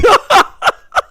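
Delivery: a shrill burst of laughter; loud high laugh. Real, not acted. Reviewer laughing at her own ring joke, loud high laugh. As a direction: cackle loudly at your own joke.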